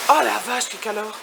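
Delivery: startled heat complaint; surprised exclamation. Real, not acted. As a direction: react to the wall of heat. Hit by the heat outside.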